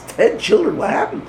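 high, startled disbelief; surprised. Retold or imitated. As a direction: react in stunned astonishment. Rebbe hears of ten children.

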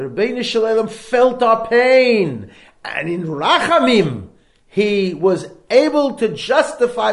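booming, impassioned preacher's voice; fervent preaching. Acted. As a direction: preach it out loud to the crowd. Preaching at full force.

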